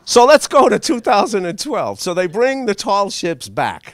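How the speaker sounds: normal talking voice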